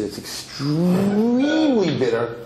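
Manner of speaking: EXTREMELY drawn way out, climbing high and loud; stretched EXTREMELY. Acted. Storyteller tells how awful the mixture tastes, dramatically stretched EXTREMELY.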